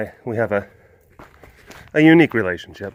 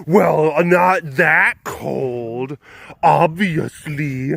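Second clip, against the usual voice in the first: drawn-out, scoffing put-on voice; mock know-it-all; much slower than usual. Someone from colder country correcting you, mock know-it-all. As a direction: imitate a dismissive one-upper, very slowly. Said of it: "someone up in ... where it's colder".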